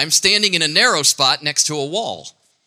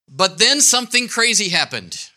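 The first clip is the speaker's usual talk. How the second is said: usual speaking voice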